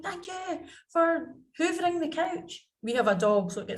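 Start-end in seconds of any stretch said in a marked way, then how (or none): 0.0-2.7 high-pitched imitation of an excited child; excited young son. Son thanking mum for hoovering, in an imitated excited high voice. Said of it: "he was so excited".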